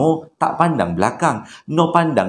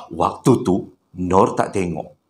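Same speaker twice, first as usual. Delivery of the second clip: ordinary talk, nothing marked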